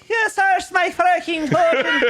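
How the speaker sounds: high laughing singsong Norwegian; mock Norwegian accent. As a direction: sing it in a Norwegian lilt. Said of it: "Do they say".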